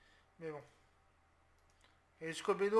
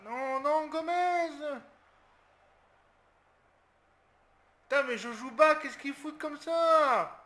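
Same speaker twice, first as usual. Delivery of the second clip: much higher, louder, slow drawn-out; anguished angry yell; 8 semitones higher, 10 dB louder, slower than usual. Real, not acted. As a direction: cry out in disbelief and anger. Erreur défensive, il s'énerve. (crie de colère, beaucoup plus aigu et fort, étiré)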